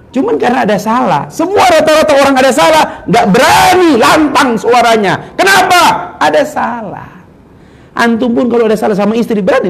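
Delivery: loud, high preaching shout; rising to a preacher's full-voiced roar; fiery preaching. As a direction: thunder it at the congregation.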